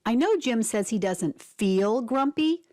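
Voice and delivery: usual speaking voice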